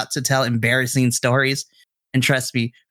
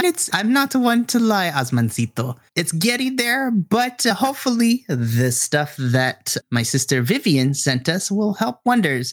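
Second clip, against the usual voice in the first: higher, gently teasing mother; teasing mom voice; 7 semitones higher than usual. Acted. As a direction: tease him kindly about his hair. Missus Garcia teasing hair.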